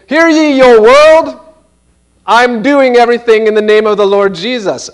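high, loud town-crier call, hammed up; mock proclamation. Preacher mocking someone who announces their faith, hammed-up herald cry. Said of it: "you say".

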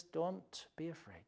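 tender reassurance. Retold or imitated. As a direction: hush a frightened child. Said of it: "and God says".

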